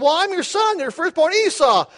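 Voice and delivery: high, eager son; Esau. Acted. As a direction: announce yourself brightly as firstborn. Esau answers his father.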